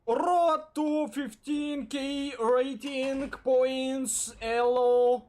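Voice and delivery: slow, loud announcer intro. Pretend. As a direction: announce it like a show host. Announcing stream title slowly.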